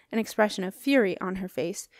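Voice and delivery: ordinary delivery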